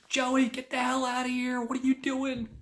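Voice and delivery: very high screech of an outraged mother; mock scream. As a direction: imitate a mom screaming at her kid to leave. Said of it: "And she screams".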